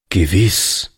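usual speaking voice

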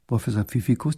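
ordinary delivery

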